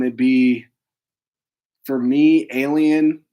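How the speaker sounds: ordinary talk, nothing marked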